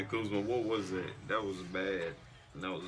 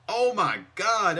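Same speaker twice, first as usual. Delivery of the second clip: high, loud, slow oh my God; stunned by a beautiful play; amazed shout; 9 semitones higher, 8 dB louder, slower than usual. Real, not acted.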